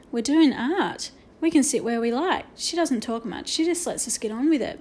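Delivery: usual speaking voice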